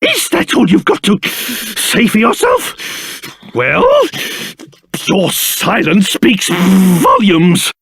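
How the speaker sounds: spluttering, affronted outrage with shivery stutters; livid. Acted. King scolding a boy for insulting him, livid and spluttering. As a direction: splutter with pompous indignation. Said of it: "Livid, I tell you".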